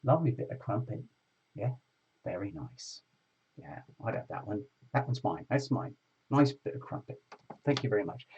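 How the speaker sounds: low, leering pub bloke voice, lightly done; a hint of a leering British lad; lecherous British bloke. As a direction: play a drunk bloke eyeing a girl in a bar. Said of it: "they would say".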